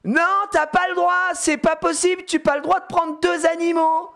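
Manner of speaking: put-on high, insistent child voice; put-on high-pitched tantrum; outraged little girl. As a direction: imitate a kid laying down the law. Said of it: "Elle lui dit".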